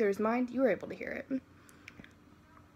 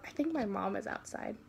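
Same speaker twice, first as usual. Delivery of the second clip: usual speaking voice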